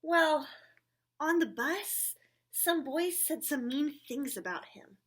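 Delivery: lively read-aloud with character voices; reading to children. Acted. Reading a picture book aloud to children, animated. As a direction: read it brightly to the little ones.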